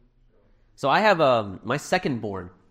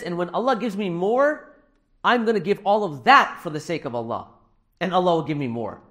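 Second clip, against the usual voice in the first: higher, emphatic quoted promise; eager son; 4 semitones higher, 3 dB louder than usual. Retold or imitated. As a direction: voice a child's keen promise, pitched up. Acting out son's eager promise.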